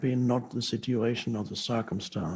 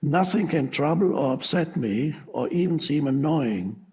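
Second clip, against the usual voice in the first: reading an excerpt; 3 semitones higher, 3 dB louder than usual. Acted. Teacher reads Epictetus excerpt to viewers, measured reading voice. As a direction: deliver the quoted passage like a reading. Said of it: "This is just a little excerpt".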